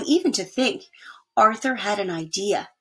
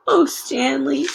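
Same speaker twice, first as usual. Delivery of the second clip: slow, higher, tearful sigh; 5 semitones higher, 4 dB louder, slower than usual. Acted. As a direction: let a little crying into it. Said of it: "Arthur couldn't help crying".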